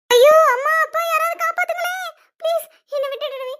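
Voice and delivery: high, wailing cries for rescue; screaming for help. Acted. Young woman calling parents to save her, screaming for help. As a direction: cry out in panic, stretching the high notes. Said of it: "இதுக்கு இப்படி கத்துறா".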